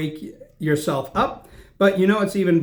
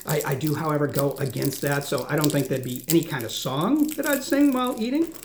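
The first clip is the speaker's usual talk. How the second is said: ordinary talk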